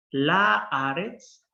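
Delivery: "La Laaretz" very slow, with a long open "aa"; slow Hebrew word. Shown as a demonstration. Teacher modelling "La Laaretz" very slowly, long open vowel. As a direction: stretch the "aa" in "La Laaretz". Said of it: "Si empieza con gutural".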